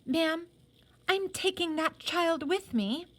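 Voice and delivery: a lightly voiced grand lady, high and haughty; through her teeth. Cilla imitates Miss Lavinia telling Ma, through her teeth. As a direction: clip it out between the teeth, imperious. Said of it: "said to Ma through her teeth like this".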